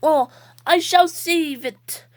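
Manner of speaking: slow, grand hero voice; heroic toy voice. Pretend. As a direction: proclaim like a superhero.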